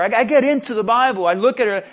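ordinary talk, nothing marked

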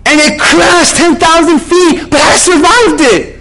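high, loud, hammed-up survivor's outburst; mock frantic shout. Passenger tells pastor of her crash, frantic shout. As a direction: belt it out as the excitable passenger.

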